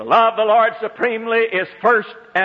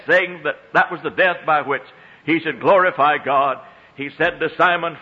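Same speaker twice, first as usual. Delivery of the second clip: ordinary delivery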